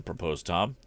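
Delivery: ordinary delivery, nothing marked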